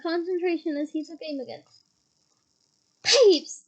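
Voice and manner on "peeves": loud sharp shout of a name; angry yell. Acted. Teacher yelling angrily at the poltergeist. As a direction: yell it up at the ceiling. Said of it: "yelled an angry voice".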